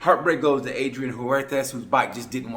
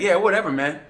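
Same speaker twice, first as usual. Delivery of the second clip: mock flippant shrug; 6 semitones higher, 5 dB louder than usual. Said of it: "he was like".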